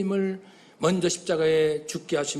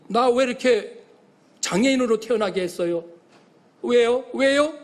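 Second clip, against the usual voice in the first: mock angry protest; 5 semitones higher, 4 dB louder than usual.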